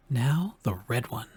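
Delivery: put-on soft, hushed child's murmur; whispered. A narrator's reading. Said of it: "On whispered".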